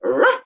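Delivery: sharp high mock bark; dog bark imitation. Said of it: "Parang mukha na rin akong aso".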